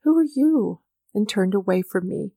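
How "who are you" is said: higher, oddly estranged question; a hint of an unnatural voice; strange unnatural voice. A narrator's reading. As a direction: ask as if to a stranger. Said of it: "in a strange, unnatural voice".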